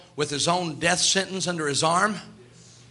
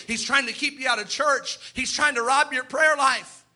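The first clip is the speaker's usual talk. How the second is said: preaching that climbs higher as it builds; building sermon; 4 semitones higher than usual. Real, not acted. Pastor rallying the congregation against Satan, rising preaching. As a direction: drive each line harder to the peak.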